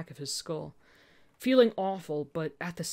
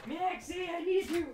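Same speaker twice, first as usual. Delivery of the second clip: much higher, soft sing-song call; calling the dog; 12 semitones higher, 3 dB quieter than usual. Real, not acted. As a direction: coax the puppy over. Calling her dog sweetly.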